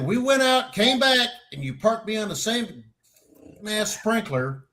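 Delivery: high-pitched mock exasperation; acted-out incredulous complaint; retold indignation. Argues his case, retold.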